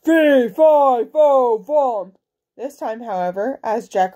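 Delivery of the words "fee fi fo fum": booming, loud giant roar; giant's shout. A narrator's reading. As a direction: bellow each word like a giant.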